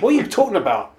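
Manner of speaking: baffled. Retold or imitated. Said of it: "And you're like".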